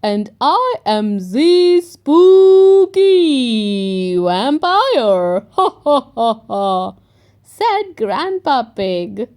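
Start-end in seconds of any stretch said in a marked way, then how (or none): 0.0-5.5 louder, hammed-up vampire; best vampire voice. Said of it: "in his best vampire voice". 5.5-6.9 hammed-up vampire ha ha; vampire laugh. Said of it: "in his best vampire voice".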